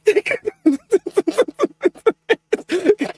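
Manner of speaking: long high-pitched giggle; laugh. Real, not acted. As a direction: keep giggling high and helplessly. Exasperated laugh at Denji's behaviour.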